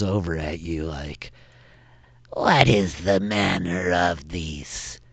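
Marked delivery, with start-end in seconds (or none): 2.3-5.0 slow, suspicious whisper; menacing kobold king. Acted. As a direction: lean in and whisper the accusation slowly.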